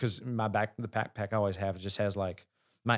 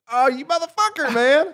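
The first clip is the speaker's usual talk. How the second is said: a much higher, louder mock outburst; exasperated yell; 11 dB louder than usual. Retelling his yell at his brother over the lost phone, high and loud. Finding phone was on tailgate.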